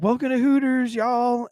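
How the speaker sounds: high-pitched Southern waitress greeting; Southern accent. Retold or imitated. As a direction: greet customers in a real Southern drawl. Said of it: "real Southern accent".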